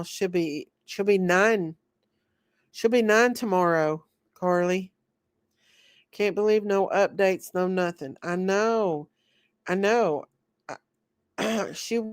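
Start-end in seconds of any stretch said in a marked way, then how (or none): none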